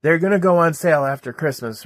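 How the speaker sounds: high, loud teasing sister. Retold or imitated. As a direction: voice the sister loudly. Sister voiced in text.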